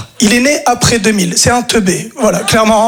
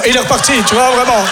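ordinary delivery

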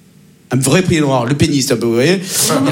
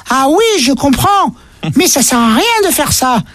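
put-on higher, slower little boy voice; voix d'enfant; 6 semitones higher, slower than usual. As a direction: innocent child telling what he saw. Enfant répond. (voix d'enfant naïve, plus lente)

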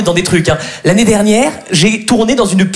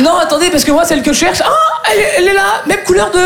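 high-pitched, panicky self-justification; flustered explaining; 11 semitones higher, faster than usual. Retold or imitated. Man explains his mistake to metro agent, flustered and high. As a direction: fumble out an excuse, voice jumping up.

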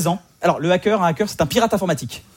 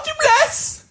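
high tearful dubbed voice; mock crying; slower than usual. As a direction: plead it in a high film-heroine wail. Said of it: "La fille pleure".

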